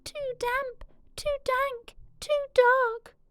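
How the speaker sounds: high, tiny squeaking mouse voice; squeaky mouse. Acted. Little mouse complaining about the damp cave, squeaky. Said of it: "Mouse squeaks".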